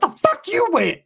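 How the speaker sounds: high shrieked Richmond dialect; Richmond accent. Retold or imitated. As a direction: squeal it in an exaggerated Richmond accent. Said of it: "by her accent, her dialect".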